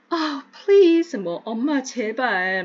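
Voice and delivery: high, loud, drawn-out "please" like a pleading daughter; mock-pleading teen daughter; whiny daughter. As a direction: beg mom, exasperated. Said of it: "이런 얘기 우리도 많이 하잖아요".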